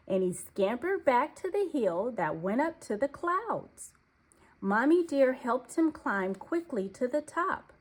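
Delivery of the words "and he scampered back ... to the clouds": ordinary delivery, nothing marked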